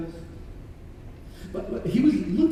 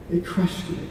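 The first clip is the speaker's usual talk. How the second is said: ordinary talk, nothing marked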